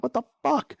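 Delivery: very high-pitched disbelief, re-enacted; incredulous retold outburst; exasperated comeback. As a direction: throw it back, voice leaping up. Acted comeback in retold argument.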